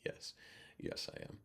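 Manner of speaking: hushed unvoiced reply, half-laughing; whisper. Actor whispering back to the waiter, hushed yes. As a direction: breathe the words out in a secret whisper.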